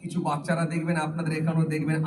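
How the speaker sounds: ordinary talk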